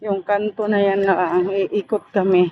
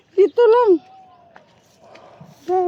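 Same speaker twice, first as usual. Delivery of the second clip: calling out; 12 semitones higher, 5 dB louder than usual. Real, not acted. Calling out to child.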